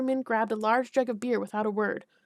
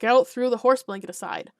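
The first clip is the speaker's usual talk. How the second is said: ordinary delivery, nothing marked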